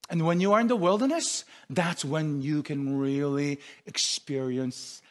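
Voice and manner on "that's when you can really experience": low, soft reverent preaching; intimate hush. Acted. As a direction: sink into a soft, close murmur. Sermon turns to God's intimate closeness.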